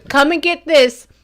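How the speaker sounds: hammed-up mom squawking for her husband to come; a half-joking imitation of a mother yelling across the house; mock squawking mother. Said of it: "I hear her squawking around screaming".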